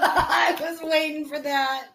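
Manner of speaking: high, drawn-out words through real laughter; laughing hard. Real, not acted. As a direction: crack up while talking. Cracks up at the punchline.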